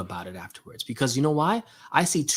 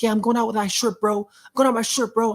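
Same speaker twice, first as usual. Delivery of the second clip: higher, louder mock of an angry guy; worked-up gym guy; 9 semitones higher, 3 dB louder than usual. Man mimicking an upset gym-goer to his viewers, higher and louder. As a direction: play the riled-up guy ranting about his shirt.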